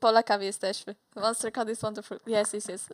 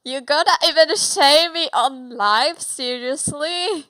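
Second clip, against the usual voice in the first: louder, outraged disbelief; indignant outburst; 3 semitones higher, 9 dB louder than usual. Real, not acted. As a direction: protest being shamed on stream. Friend teases her height live.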